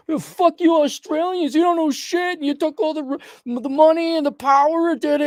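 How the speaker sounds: mock angry whiner. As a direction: squeal it like a petty complainer. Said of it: "And don't say".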